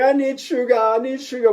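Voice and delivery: high whiny crying germ voice; crying pathogens. Pretend. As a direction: beg for sugar like a hungry baby.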